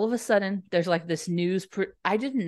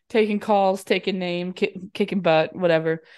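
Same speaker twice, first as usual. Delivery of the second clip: ordinary delivery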